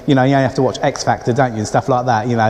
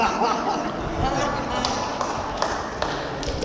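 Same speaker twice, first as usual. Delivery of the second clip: breathy laugh at the memory; soft laugh; 9 semitones higher, 10 dB quieter than usual. Real, not acted. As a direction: laugh quietly at the story. Laughing after wife's line.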